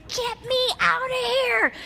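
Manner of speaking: high mock-panicked squeak; panicked plea. Nervous speaker begging to leave the stage, high mock panic. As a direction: squeak it like a scared kid wanting out. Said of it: "I was saying".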